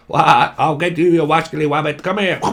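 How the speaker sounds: hammed-up Elmer Fudd voice. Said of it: "you wascally rabbit".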